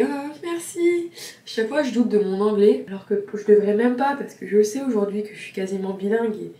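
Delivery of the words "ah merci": high, drawn-out mock-bashful thanks; flattered reaction. Vlogger acting out her reply to praise of her English, flattered. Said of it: "Et là, j'étais".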